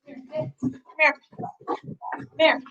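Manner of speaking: high sing-song call to the dog. Real, not acted. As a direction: coax the dog over, up high. Calling the dog.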